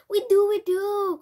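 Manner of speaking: high, very loud, urgent cry; alarmed cry. A narrator's reading. Father admits to keeping a cat, crying out in alarm. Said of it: "cried Mister Jenkins".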